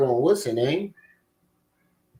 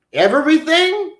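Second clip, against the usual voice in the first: "Everything?" jumps much higher as an incredulous question; disbelieving question; 11 semitones higher than usual. Pretend. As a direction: ask it back high, in disbelief. Asks back in disbelief.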